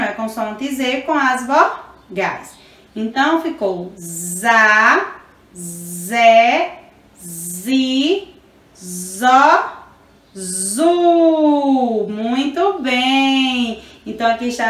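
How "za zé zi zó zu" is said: za, zé, zi, zó, zu one by one with pauses; teacher chanting out za, zé, zi, zó, zu; syllable family recital. Shown as a demonstration. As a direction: recite "za, zé, zi, zó" separately, then stretch "zu". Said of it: "temos a família da consoante Z".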